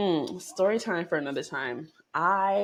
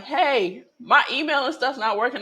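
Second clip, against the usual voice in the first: calling out; 8 semitones higher, 5 dB louder than usual. Retold or imitated. Calling for tech help.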